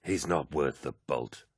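low, slow, dismissive mutter. A narrator's reading. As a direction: mutter it under your breath. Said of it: "muttered Aximand".

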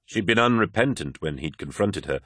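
plain reading voice

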